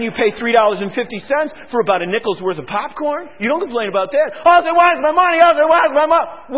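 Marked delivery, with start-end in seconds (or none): 4.5-6.6 shouted whine, higher and louder; mock complaint. Griping churchgoer shouting about his money. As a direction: whine it like a stingy member. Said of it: "mocking complainers".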